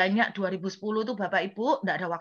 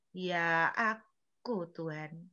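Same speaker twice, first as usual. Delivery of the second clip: sheepish admission; 4 semitones lower, 7 dB quieter, much slower than usual. Retold or imitated. Admits guilt to God.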